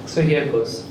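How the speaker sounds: usual speaking voice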